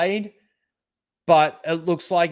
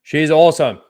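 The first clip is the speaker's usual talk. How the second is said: louder, punching out "awesome"; emphatic repeat; 8 dB louder than usual. Real, not acted. YouTuber repeating praise for a friend, louder and emphatic. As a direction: shout "She's awesome" like you mean it.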